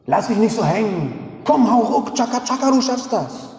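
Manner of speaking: high, pushy cheerleader voice; mocking motivation guru. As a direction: parody an overeager self-help coach. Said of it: "die geben mir dann ... einen Ratschlag". (spöttisch nachgeahmter Motivationsguru, hoch und aufdringlich)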